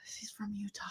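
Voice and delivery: soft whispered correction; whispered aside. Real, not acted. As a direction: whisper it to the audience. Correcting him under her breath.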